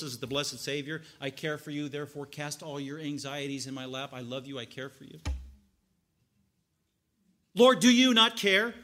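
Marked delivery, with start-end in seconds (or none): 1.2-5.2 tender reassurance. Retold or imitated. Savior's tender words contrasted. 7.5-8.7 loud, slow demanding question; loud accusing question. Retold or imitated. Martha confronting Jesus, loud and slow.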